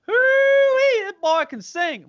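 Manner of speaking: whooping, high-pitched southern country drawl. Retold or imitated. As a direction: imitate a country boy hollering in a big southern drawl. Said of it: "That's my really southern drawl accent".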